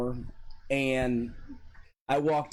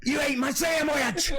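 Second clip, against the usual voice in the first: angry coach shout; 13 semitones higher than usual. Retold or imitated. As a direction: yell the accusation like the furious coach. Coach's accusation acted out.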